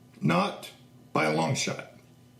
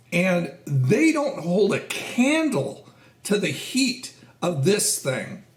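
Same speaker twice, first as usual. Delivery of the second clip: normal talking voice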